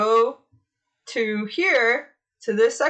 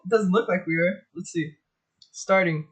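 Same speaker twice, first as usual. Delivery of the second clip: usual speaking voice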